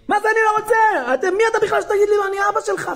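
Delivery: loud, high, fast angry outburst; attacking father. Retold or imitated. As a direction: re-enact a dad blowing up at his child. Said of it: "תקפתי אותו". (אבא מתפרץ בכעס, בצעקות)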